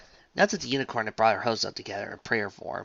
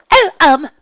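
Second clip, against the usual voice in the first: flustered; 18 dB louder than usual. A narrator's reading. Rarity flustered.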